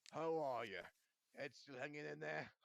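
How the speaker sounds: loud, booming jovial greeting; hearty roar. A narrator's reading. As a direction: greet him with boisterous teasing. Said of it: "he roared".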